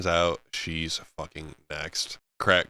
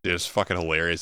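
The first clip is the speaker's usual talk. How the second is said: usual speaking voice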